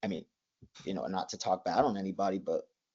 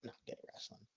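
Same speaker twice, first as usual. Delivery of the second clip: soft mock whisper of a top wrestler's verdict; imagined dismissive thought; 20 dB quieter, faster than usual. Rival's hidden thought, whispered dismissively. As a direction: breathe it out like a private put-down. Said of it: "guys like that, like".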